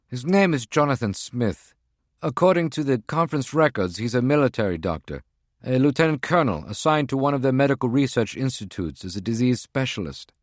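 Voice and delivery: a tense field agent reporting in; agent's voice. Acted. Voices Prague One on phone.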